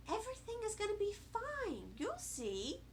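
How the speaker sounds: soft, soothing mom voice; reassuring mother. A narrator's reading. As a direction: comfort the child gently. Mother reassures child in story.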